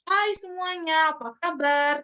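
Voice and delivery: slow, high, bright welcome to the room; friendly greeting. Shown as a demonstration. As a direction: draw the audience in with a warm hello. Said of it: "friendly gitu".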